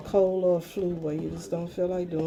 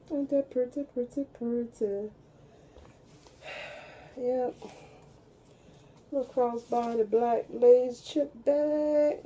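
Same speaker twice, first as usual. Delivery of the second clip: gushing; 6 semitones higher than usual. Real, not acted. Gushing over her new bag.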